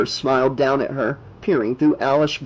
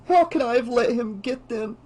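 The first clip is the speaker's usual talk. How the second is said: high, tearful, halting voice; soft crying; 9 semitones higher than usual. Acted. Girl blames herself for friends' deaths, tearful high voice. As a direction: choke it out in broken starts, voice high. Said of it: "She began to cry softly".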